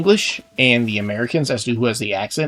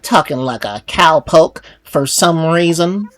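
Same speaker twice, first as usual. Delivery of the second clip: louder cowboy drawl, higher pitched; Western accent; 5 semitones higher, 5 dB louder than usual. Acted. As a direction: play a cowpoke with a Western accent. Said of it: "a Western accent".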